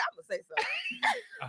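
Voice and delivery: mock fed-up resolve. Imitating fed-up audience member.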